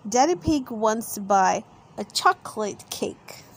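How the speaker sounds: ordinary delivery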